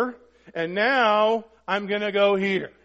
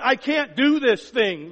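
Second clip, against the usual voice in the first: pleading surrender; 6 semitones higher, 5 dB louder, slower than usual. Acted. Voicing a believer surrendering to God.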